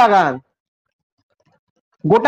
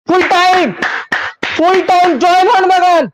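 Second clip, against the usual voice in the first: joyful call of full time, a little higher; full-time cheer; 4 semitones higher than usual. Real, not acted. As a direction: announce the win like a fan bursting. Final whistle, team wins. (খুশিতে চিৎকার)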